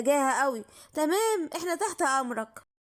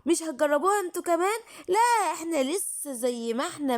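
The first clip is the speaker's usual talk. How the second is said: ordinary talk, nothing marked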